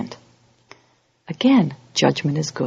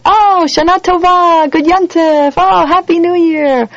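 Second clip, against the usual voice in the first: high, slow, sing-song holiday greeting; mock cheery greeting; 11 semitones higher, 5 dB louder, slower than usual. Congregants on Rosh Hashanah greeting each other, mock cheery. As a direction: gush the greeting like everyone is oblivious. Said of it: "walking around on Rosh Hashanah going".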